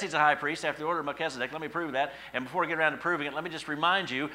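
ordinary talk

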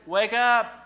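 high, loud command; wake-up call. Acted. Preacher telling the dull listeners to wake up, loud and high. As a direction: snap them out of it. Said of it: "you are dull of hearing".

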